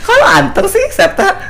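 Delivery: high, loud playful tease; high teasing call. Real, not acted. Teasing quiet cohost.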